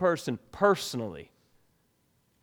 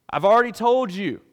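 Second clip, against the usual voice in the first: higher, louder, fed-up retort; frustrated; 5 semitones higher, 6 dB louder than usual. Retold or imitated. Blind man answering the Pharisees again, frustrated. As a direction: let the frustration show. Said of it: "you can kinda sense frustration".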